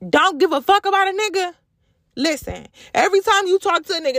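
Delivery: usual speaking voice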